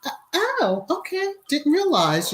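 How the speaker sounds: mock surprise. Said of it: "it's like".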